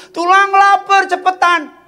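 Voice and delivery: high, loud insistent demand; impatient little relative. Retold or imitated. Hungry child hurrying uncle along, high insistent call. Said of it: "keponakan saya minta".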